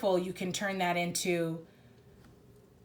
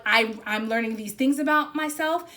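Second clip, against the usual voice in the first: higher and louder, insisting on being yourself; passionate self-assertion; 5 semitones higher, 7 dB louder than usual. Retold or imitated. Reader voicing the client standing up for themselves, higher and louder. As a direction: declare it with rising fire. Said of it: "you're like".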